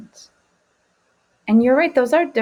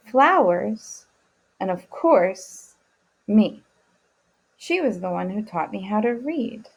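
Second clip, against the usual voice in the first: gentle storytelling read-aloud; reading to children; slower than usual. Acted. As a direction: tell the grandmother's story slowly to the kids. Reads father's story aloud.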